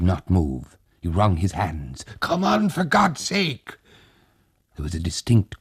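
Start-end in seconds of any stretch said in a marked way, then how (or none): 2.2-3.8 much higher, slow, begging; desperate plea. Acted. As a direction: plead urgently, frightened. Tag wrings hands, pleads.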